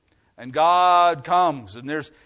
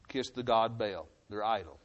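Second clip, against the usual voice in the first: usual speaking voice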